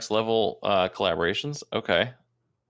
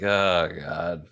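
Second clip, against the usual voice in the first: slow, lower, tired oh God; mock groan; 6 semitones lower than usual. Teasing friend echoing the son's weary groan, slow and low. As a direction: drag it out like the fed-up son. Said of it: "echoing the son's groan".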